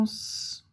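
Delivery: long sustained hiss for "S"; pure held sss on "S"; isolated S sound. Shown as a demonstration. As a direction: say "S" as a drawn-out sss, not the letter name. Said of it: "tu entends le son S".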